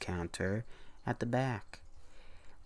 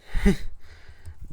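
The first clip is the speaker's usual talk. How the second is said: burst of laughter; 11 semitones higher, 8 dB louder than usual. Real, not acted. Reader laughs after the story.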